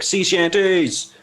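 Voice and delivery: drawn-out announcement. Real, not acted. Slow, high playful announcement.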